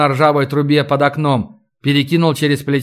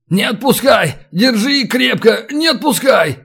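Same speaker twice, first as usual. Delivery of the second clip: high, urgent pleading shouts; acted high-pitched yell of alarm; panicked cry; 7 semitones higher than usual. A narrator's reading. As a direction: cry out as if about to be dropped. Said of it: "и закричал".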